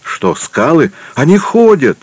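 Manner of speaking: questioning, then leaping very high; high-pitched amazed exclamation; astonished alarm. A narrator's reading. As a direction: cry out in disbelief, pitch soaring at the end. Startled boatman sees rocks moving.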